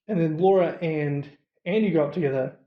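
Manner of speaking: ordinary delivery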